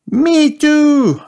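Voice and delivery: very high, loud squeal; high-pitched childish chorus cry; shouting children. A narrator's reading. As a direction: shout it like eager little friends. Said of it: "shouted all Peppa's friends".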